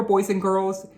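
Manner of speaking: ordinary delivery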